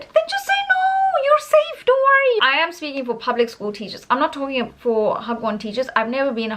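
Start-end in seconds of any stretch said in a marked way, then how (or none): 0.0-2.5 very high-pitched, comic comforting; high, exaggerated don't-panic voice; playful reassurance. Real, not acted. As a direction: reassure them in a sky-high voice. Playfully reassuring worried teachers.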